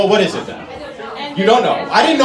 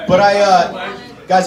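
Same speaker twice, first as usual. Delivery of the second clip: ordinary delivery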